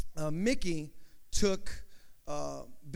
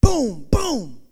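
two loud, booming shouts; shotgun blasts; 7 semitones higher, 12 dB louder than usual. Acted. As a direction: shout it like gunfire, high and explosive. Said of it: "he hears two shotguns".

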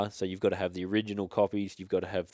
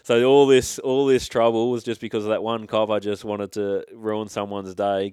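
indignant outburst; 8 dB louder than usual. Real, not acted. Indignant about the copper.

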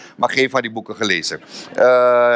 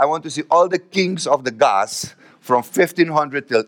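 slow, deliberate request; slow demand; 3 semitones higher than usual. Retold or imitated. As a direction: spell out the request slowly. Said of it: "Ik heb gezegd". (langzaam en nadrukkelijk verzoek)

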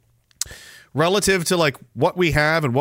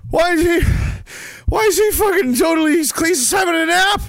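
high, strained yelling in disbelief; mock outraged shout; 13 semitones higher, 3 dB louder than usual. War casualty griping at napping Switzerland, hammed-up outrage. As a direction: yell it high, stumbling in outrage.

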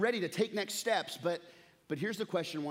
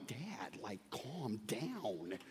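much softer, slow hush to calm him; calming dad; 7 semitones lower, 10 dB quieter, much slower than usual. Retold or imitated. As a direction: soothe an upset father, slow and soft. Son calming his dad.

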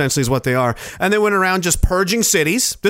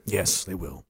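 deep ominous mutter; 12 semitones lower, 12 dB quieter than usual. Acted. Deep quiet ominous agreement.

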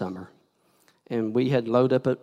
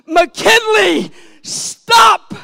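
full-voiced high shout of alarm; desperate warning shout; 15 dB louder, much slower than usual. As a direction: yell it to stop a child at the street. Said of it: "I lift my voice and I shout".

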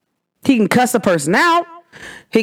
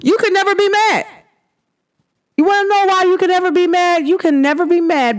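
heated insistence; 8 semitones higher than usual. Real, not acted. Ranting at the teacher.

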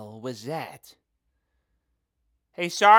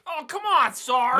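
whiny protest; 14 semitones higher, 9 dB louder than usual. Acted. Whining at sergeant's punishment.

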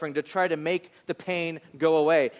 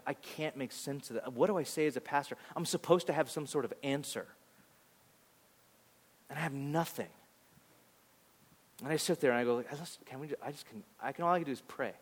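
much softer, faltering, nearly inaudible; a pastor with no answer for the grieving; helpless and hushed; 3 semitones lower, 10 dB quieter than usual. Retold or imitated. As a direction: let the words die away in helplessness. Pastor lost for words with grieving families.

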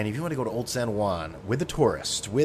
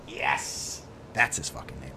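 low aside; 9 semitones lower, 7 dB quieter, slower than usual. Real, not acted. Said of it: "the name".

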